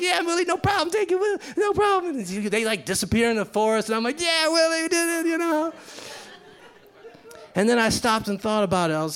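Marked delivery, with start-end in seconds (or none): 0.0-5.7 high, happy reply; elated. Retold or imitated. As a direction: beam it back at him. Narrator elated.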